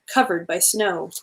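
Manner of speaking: ordinary delivery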